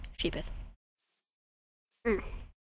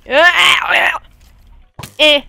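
sudden yelp; 8 semitones higher, 10 dB louder than usual. Real, not acted. Sudden outburst.

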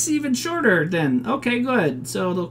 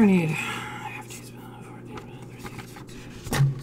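hushed whisper while working out measurements; whispering to oneself; 4 semitones lower, 7 dB quieter than usual. Real, not acted. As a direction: whisper the amounts under your breath.

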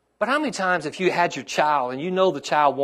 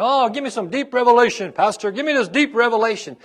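mock pleading churchgoer; 8 semitones higher, 6 dB louder than usual. Pastor mocking members begging for revelation, lightly whiny. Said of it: "people who want this deep revelation".

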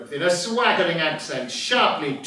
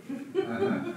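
soft high chuckle; 13 semitones higher, 9 dB quieter than usual. Acted. Quiet chuckle.

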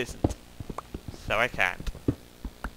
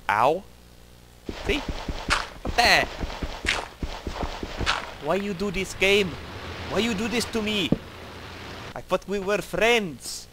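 lightly put-on broken-English accent, higher; weird mock accent; 5 semitones higher than usual. Player scolds the game in a weird mock accent. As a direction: whine at the game in a funny foreign accent. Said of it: "Sorry for the weird accent".

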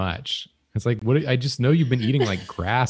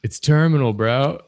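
surfer bro; 4 semitones higher, 5 dB louder than usual. Pretend. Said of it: "surfer bro doctor".

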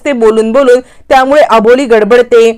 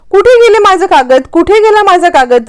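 flustered; 7 semitones higher than usual. Retold or imitated. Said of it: "आता अबोली गडबडते".

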